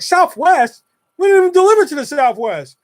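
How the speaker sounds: high, loud, indignant disbelief; incredulous. Retold or imitated. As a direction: balk at the address in disbelief. Clerk balks at customer's address.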